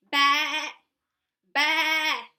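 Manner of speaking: loud bleating ba; sheep baa. Shown as a demonstration. Sheep sound for child.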